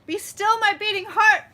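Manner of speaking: high, loud, hammed-up lovestruck swoon; mock swoon. Streamer swooning over a viewer's news, hammed-up and loud. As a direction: play the swooning romantic heroine.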